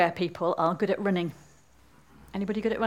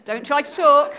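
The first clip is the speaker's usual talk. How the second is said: raised, commanding voice; crying out; 4 semitones higher, 6 dB louder than usual. Acted. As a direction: order it loudly, pitched a little higher. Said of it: "for she cried".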